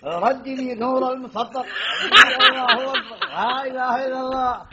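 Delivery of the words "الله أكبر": shouted high with a laugh; loud laughing takbir. Acted. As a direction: burst out with it, laughing.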